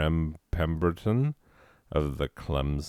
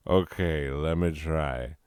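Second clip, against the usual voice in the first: normal talking voice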